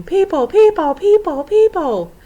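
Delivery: very high, loud, repeated screech; screaming creatures. A narrator's reading. Tree squeaks warning of intruders, screaming. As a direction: scream it over and over like a shrieking flock. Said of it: "he realized they were screaming".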